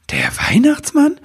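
high, whispered disbelief; astonished. Acted. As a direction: gasp the name in wonder. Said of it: "fragte Nibelwurz verwundert".

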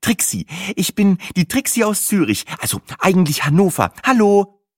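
high-pitched introduction; flustered latecomer. A narrator's reading. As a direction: introduce yourself high, ending on a loud hello. Flustered latecomer introduces herself to bus.

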